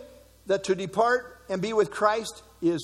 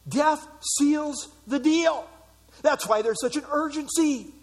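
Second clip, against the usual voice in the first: ordinary talk, nothing marked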